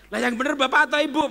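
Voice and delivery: quick, loud and high; mock challenge from the crowd; excited challenge. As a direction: toss the challenge out fast. Voicing congregation's challenge.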